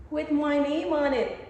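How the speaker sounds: thrilled reveal of her name on the jersey; excited. Real, not acted. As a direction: show off the name proudly.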